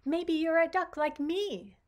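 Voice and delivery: high, loud duckling voice; eager duckling. Acted. Duckling hopefully tells Sprout he might be a duck, high and loud. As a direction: chirp it out brightly like a little duck.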